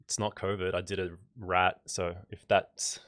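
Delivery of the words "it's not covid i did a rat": ordinary talk, nothing marked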